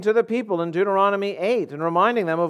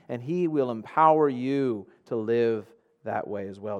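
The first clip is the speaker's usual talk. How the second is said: ordinary talk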